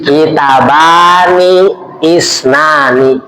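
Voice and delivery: kitabani isnani drawn out very slowly, each syllable stretched; slow drilled Arabic. Shown as a demonstration. Ustaz teaching the dual form to the congregation, slow and stretched. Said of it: "Kalau dua kitab".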